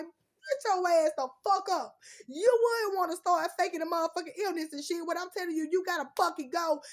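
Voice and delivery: loud, high retold order to get up; angry yelling. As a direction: bark at him to get out. Retelling yelling at ex.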